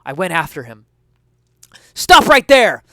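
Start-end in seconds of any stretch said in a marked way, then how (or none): 1.9-2.8 high, loud shout mid-chase; yelling while running. Acted. Detective chasing the suspect shouts him down, high and loud. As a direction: bellow it on the run. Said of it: "I yelled as I ran".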